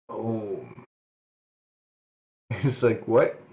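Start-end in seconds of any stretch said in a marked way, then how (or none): none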